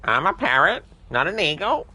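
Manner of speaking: parrot voice. Pretend. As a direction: talk like a cheeky parrot. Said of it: "so I can do a parrot one".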